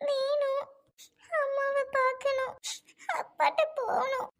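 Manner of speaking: put-on high, slow childlike whimper; like a little child. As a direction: whine for mother like a small child. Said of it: "என்ன சுவாதி குழந்தை மாதிரி?". (சின்ன குழந்தை போல சிணுங்கல்)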